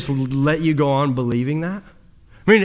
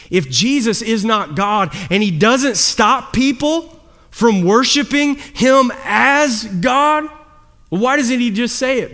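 preaching appeal; 6 semitones higher, 4 dB louder, slower than usual. Acted. Preaching builds up.

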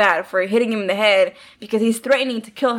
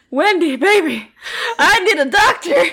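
high, louder wheedling impression of an injured man; mock pleading husband; 7 semitones higher, 4 dB louder than usual. Husband locked in the freezer pleads with wife, mock pleading. Imitating Jack pleading with Wendy.

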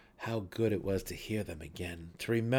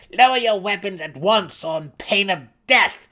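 furious command; 9 semitones higher, 14 dB louder than usual. A narrator's reading. Brisa threatens her brother, furious shout. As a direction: threaten with death.

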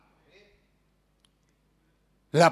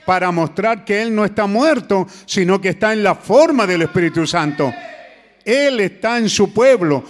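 loud, building preaching climax; predicación con fuerza; 5 dB louder than usual. Acted. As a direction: preach it out with full force. (predicando con fuerza y pasión)

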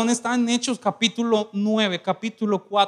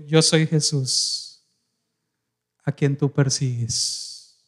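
solemn reply; 7 semitones lower, much slower than usual. Acted. Jesus answers Saul.

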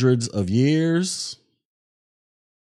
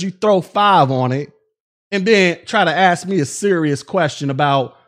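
heated rant; 5 semitones higher than usual. Real, not acted. Ranting at rude donor.

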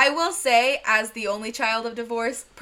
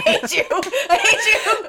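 drawn-out, laughing mock hatred; laughing protest; 9 semitones higher, 5 dB louder, slower than usual. Real, not acted. Tells a co-host they hate them, laughing protest. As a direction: protest through a laugh, high and slow. Joking complaint while laughing.

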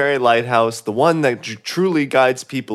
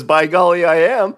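eager; 5 semitones higher, 7 dB louder than usual. Acted. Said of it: "And she says".

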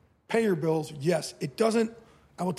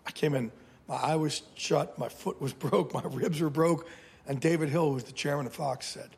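usual speaking voice

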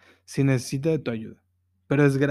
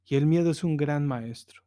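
ordinary talk, nothing marked

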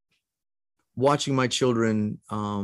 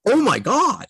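high, loud astonished exclaim; amazed gasp; 12 semitones higher, 4 dB louder than usual. Retold or imitated. Nurses stunned as the newborn turns his head, high amazed cry. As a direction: let it burst out in amazement. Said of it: "the nurses and the doctors were like".

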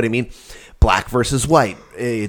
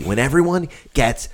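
ordinary talk

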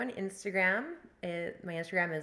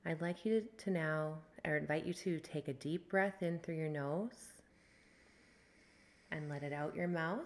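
guided meditation. Acted. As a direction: ease them into the breath. Leads a breathwork meditation.

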